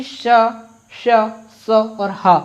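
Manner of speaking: श, स and ह drawn out as hissed friction; fricative demo. Shown as a demonstration. Teacher demonstrates the fricatives श स ह to the class. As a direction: let the air hiss through श and स, breathe out the ह. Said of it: "इसको pronunciate ही करके देख लो कि".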